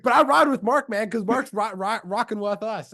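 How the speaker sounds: high, hyped-up coach imitation; excited coach. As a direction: imitate a coach celebrating, pitched right up. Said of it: "he's, like, all excited".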